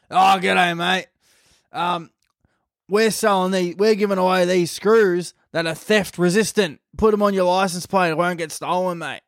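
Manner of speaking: put-on chummy policeman's pitch; friendly cop. Acting out the cop's sales pitch.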